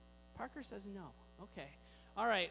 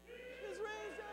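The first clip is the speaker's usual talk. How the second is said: group shout; 9 semitones higher, 12 dB quieter, faster than usual. Shown as a demonstration. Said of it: "we're gonna try it".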